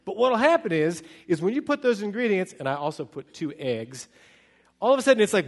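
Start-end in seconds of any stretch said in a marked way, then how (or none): none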